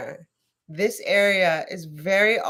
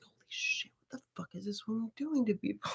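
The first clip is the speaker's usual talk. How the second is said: shocked whisper; 4 semitones higher, 12 dB quieter, faster than usual. Retold or imitated. Her shocked inner reaction.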